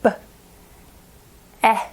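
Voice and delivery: B as a short puff, then a separate eh; clipped B, pause, short eh; letter sounds. Shown as a demonstration. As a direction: say B and eh as isolated sounds. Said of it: "get your mouth ready".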